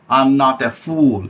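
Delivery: "fool" with a long stretched oo; slowly, dragging out the vowel in "fool"; drawn-out vowel. Shown as a demonstration. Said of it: "এই যে টান হবে". ("fool" লম্বা টান দিয়ে)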